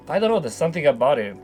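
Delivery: usual speaking voice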